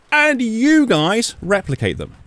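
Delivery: high, slow accusation; accusing. Real, not acted. Accusing the organizations.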